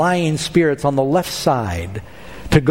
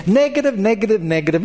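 mocking; 5 semitones higher than usual. Ahab mocking Micaiah's warnings.

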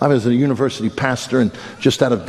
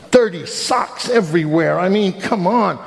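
slow, higher disbelief; mock dismay; 5 semitones higher, slower than usual. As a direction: drag it out in comic outrage. Dismay at messy living room.

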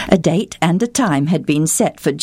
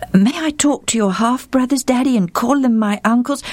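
put-on high, breathless little girl's voice; excited girl searching for words; young daughter; 9 semitones higher than usual. A narrator's reading. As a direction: play the eager, stammering daughter. Daughter asks father.

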